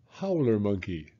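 plain reading voice

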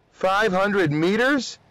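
high, drawn-out questioning; stunned, slowly voiced question; incredulous. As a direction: balk at it in disbelief, pitched high. Alarmed cadet questions jump order.

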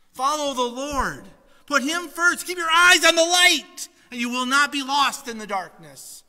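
high, loud, driving sermon voice; preaching at full force. Acted. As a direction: drive the warning home from the pulpit. Preaches the story's lesson.

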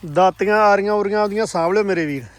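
hearty loud trucker banter. Real, not acted. Calling to brother, packing tools.

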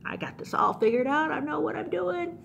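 comic know-it-all singsong; cocky Balaam. Acted. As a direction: pitch climbing in breezy overconfidence. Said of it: "Balaam's just like".

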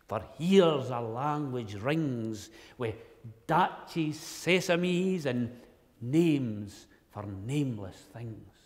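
loud, slow Scots poem recital. Acted.

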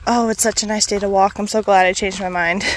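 normal talking voice

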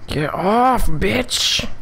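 high angry yell at the screen; sudden high-pitched snap at an opponent; yelling at enemy. Real, not acted. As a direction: shout them off you, high and sharp.

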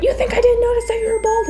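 very high-pitched retort; mock indignation. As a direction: imitate an outraged woman in a squeaky-high voice. Acted quarrel, woman hits back.

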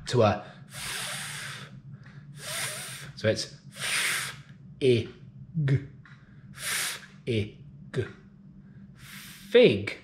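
f hissed unvoiced, then I, g, Fig; sounding out words. Shown as a demonstration. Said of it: "change the p".

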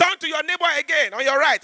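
high, insistent pastor call; lightly voiced pastor giving orders; mock preacher. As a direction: play the pastor pushing the church on. Pastor quoted in story.

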